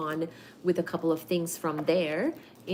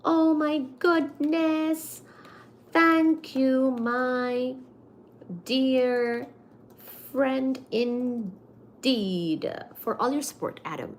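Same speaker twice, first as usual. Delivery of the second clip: moved thanks; 4 semitones higher, much slower than usual. Real, not acted.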